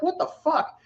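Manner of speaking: high, incredulous outburst; retold disbelief. To a friend about Vinny fleeing, high incredulous disbelief.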